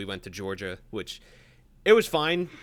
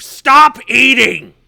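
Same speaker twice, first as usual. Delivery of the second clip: slow, very loud, higher bark; angry dad shout; 11 semitones higher, 17 dB louder, much slower than usual. Retold or imitated. Dad yelling at his son over breakfast, furious shout. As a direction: roar it at the kid. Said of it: "and says".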